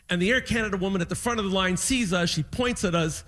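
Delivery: ordinary talk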